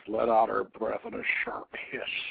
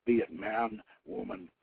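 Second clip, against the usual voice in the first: trailing whisper, growing softer; 4 semitones lower, 7 dB quieter than usual. Acted. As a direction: let it die away into a whisper. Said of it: "she whispered".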